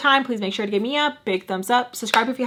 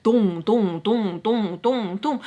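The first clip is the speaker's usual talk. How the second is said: imitated thudding heartbeat, evenly repeated; anxiety heartbeat; much slower than usual. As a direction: thump out an anxious pulse in dull beats. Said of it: "my anxiety was like".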